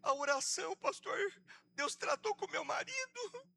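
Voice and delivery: high, wailing imitation of the wife; desperate caller. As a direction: wail it out like a frantic woman. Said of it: "Ela me liga desesperada".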